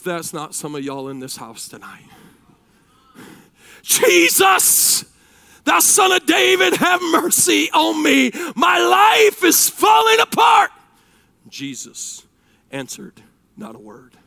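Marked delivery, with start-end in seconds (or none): 3.8-10.7 high, loud, drawn-out plea; desperate crying out. Acted. Believer whose life falls apart begging Jesus, desperate shout. 11.5-14.2 hushed narration. A narrator's reading. Preacher telling how Jesus stayed silent, hushed. Unanswered plea, hushed contrast.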